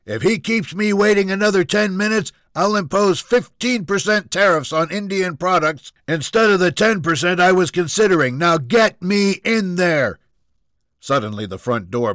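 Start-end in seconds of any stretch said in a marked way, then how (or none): 0.0-10.2 angry bellow. Acted. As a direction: keep bellowing at full force. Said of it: "Junior bellowed".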